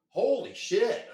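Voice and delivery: reliving a stunned moment, stretched out; stunned exclamation. Retold or imitated. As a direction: let it out slowly, loud, in shock. Reliving shock at being hit.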